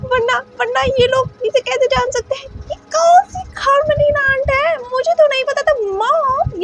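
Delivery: put-on tearful upset boy. Wing, upset, accuses mother of being bewitched.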